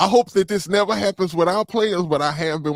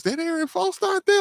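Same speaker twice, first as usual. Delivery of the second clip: surprised; 13 semitones higher than usual. Retold or imitated. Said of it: "I was like".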